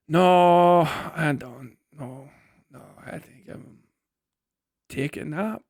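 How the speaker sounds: a put-on Irish brogue, half asleep, declining the fight; sleepy Irish accent. Tired Irishman refusing to go brawl, in a sleepy mock Irish accent. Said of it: "think I'm doing a Scottish accent anyway".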